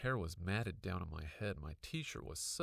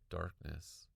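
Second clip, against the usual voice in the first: usual speaking voice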